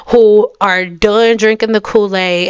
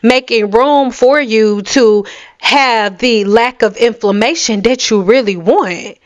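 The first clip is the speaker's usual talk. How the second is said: ordinary delivery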